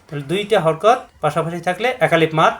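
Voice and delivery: ordinary talk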